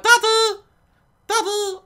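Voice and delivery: little daughter. Retold or imitated. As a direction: call for daddy like a small girl. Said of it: "she's like".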